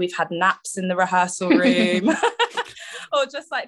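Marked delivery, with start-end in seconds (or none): 2.2-3.1 airy giggle; quick unvoiced laugh; breathy high laugh. Real, not acted. Laughing at own remark.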